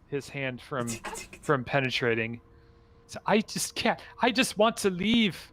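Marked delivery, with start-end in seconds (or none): 3.1-5.4 higher-pitched, helpless voice; despairing plea. Acted. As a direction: plead like a weary captive. Trapped figure laments captivity.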